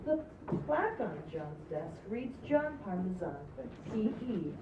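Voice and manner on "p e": normal talking voice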